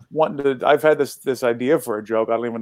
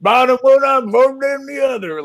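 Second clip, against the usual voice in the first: mock Southern bayouism; 12 semitones higher, 3 dB louder than usual. Son mocking dad's cryptic bad news, exaggerated Southern accent. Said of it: "like, a Southern bayouism".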